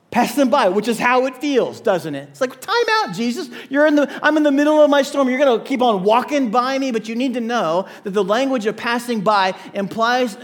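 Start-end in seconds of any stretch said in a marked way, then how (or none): 2.6-6.9 fast, louder, higher protest at Jesus; mock frustrated cry to Jesus; exasperated plea. Voiced complaint to Jesus mid-storm.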